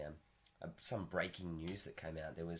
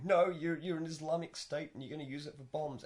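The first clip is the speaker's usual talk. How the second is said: loud, raised-pitch mock accusation; acted accusation; 8 semitones higher, 4 dB louder than usual. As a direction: play an indignant government accusing its rival. Acts out accusation against Iran.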